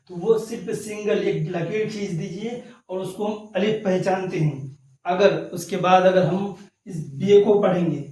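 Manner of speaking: ordinary talk, nothing marked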